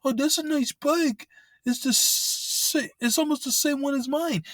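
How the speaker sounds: exaggerated falsetto mockery of a bike thief's flattery; mocking scammer. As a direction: ham up the thief's sweet-talk. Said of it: "scammers or fucking thieves say that shit".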